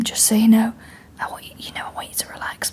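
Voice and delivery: hushed whisper in the ear; imitated masseuse. Masseuse leaning to the client's ear, imitated whisper. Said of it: "she leant down to his ear".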